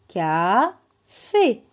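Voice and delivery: CAFÉ split loudly into "ka" and "fé"; syllable by syllable. Shown as a demonstration. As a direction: read "café" with a gap between syllables. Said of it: "on le lit ensemble".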